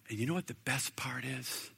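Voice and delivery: hushed secret to the congregation; stage-whispered build-up. Real, not acted. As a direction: lean in and whisper it. Confiding aside to congregation.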